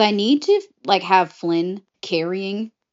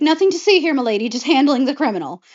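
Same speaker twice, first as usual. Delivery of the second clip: lightly acted, high, quick brush-off; mock officer's reassurance; 7 semitones higher, 4 dB louder, faster than usual. Atticus to milady while pouncing on Flynn, mock breezy reassurance. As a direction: wave it off in a raised voice.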